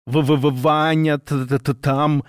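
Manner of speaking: teeth chattering, terrified stammer; stammering with fear. A narrator's reading. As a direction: stutter it out like she's frozen stiff. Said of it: "Женщина говорила как замёрзшая".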